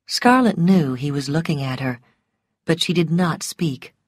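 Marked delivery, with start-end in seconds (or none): none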